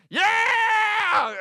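very high, loud drawn-out yell; thrill-ride whoop. Retold or imitated. Dad on the ride alone, whooping very high and loud. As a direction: let it rip as the coaster launches. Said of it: "And I'm just like".

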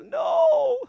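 very high, loud, drawn-out "No"; anguished scream. Acted. Viewer screaming inside as Spock dies, anguished scream. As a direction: scream out the grief, hammed-up. Acted inner cry at Spock's death.

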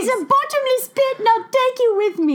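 sweet nanny turning creepy; Mary Poppins voice. Acted. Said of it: "'cause Mary Poppins".

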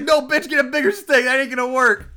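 hammed-up put-down; mock scolding. Voicing Johnny scolding Katie.

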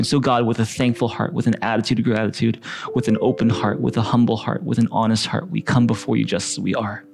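gentle worship-leader prayer. Acted. Leads a prayer over music.

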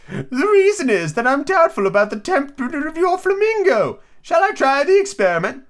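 high-pitched storybook Duchess; Duchess character voice. Acted.

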